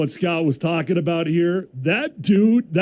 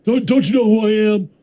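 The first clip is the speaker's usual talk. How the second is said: mock indignant Rodgers; 6 semitones higher than usual. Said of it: "he said".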